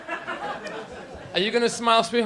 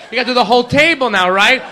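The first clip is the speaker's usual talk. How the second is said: usual speaking voice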